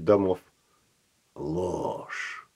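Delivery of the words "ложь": low, husky, languid seducer; husky Don Juan voice. Acted. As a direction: purr it like a seasoned ladies' man. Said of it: "хрипловатым и томным голосом бывалого Дон Жуана".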